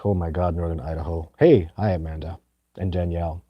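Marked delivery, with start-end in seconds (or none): none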